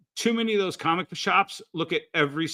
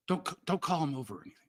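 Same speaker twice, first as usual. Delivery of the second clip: fast, softer pleading undertone; hurried hush; 7 dB quieter, much faster than usual. Retold or imitated. Brian begging him not to call Jeff over, fast and hushed. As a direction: rush it out under your breath.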